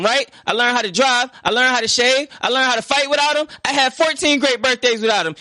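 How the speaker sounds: a son pouring out years of hurt; hurt, angry outburst. Acted. Will tells Uncle Phil he never needed his father, rising to a hurt shout. Acted breakdown scene, first take.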